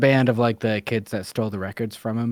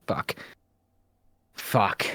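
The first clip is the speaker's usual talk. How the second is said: reluctant admission; 3 dB louder, much slower than usual. Retold or imitated. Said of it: "and you're like".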